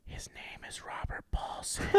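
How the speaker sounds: hushed, voiceless whisper. Acted. Lap dance girl whispering to the golfer, breathy whisper. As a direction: whisper it very softly, all breath. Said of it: "She whispers, she's like".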